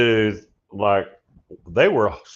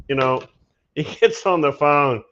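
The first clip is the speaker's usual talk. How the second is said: ordinary talk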